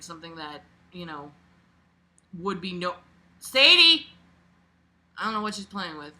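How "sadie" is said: loud, high call across the room; sudden loud call to a pet. Real, not acted. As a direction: call the dog's name sharply. Calling out to a pet.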